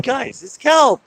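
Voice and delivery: high-pitched retold plea; mock exasperation. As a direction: call out as if reasoning with silly animals. Repeats own plea to sea lions.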